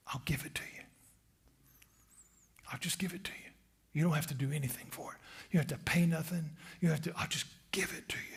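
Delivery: soft quick whispered offer; tempter's whisper. Acted. Tempter offering everything free, in a quick whisper.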